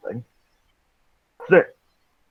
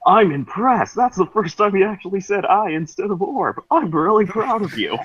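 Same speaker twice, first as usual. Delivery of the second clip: happy exclaiming; 10 semitones higher than usual. Acted. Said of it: "Alex exclaimed with happiness".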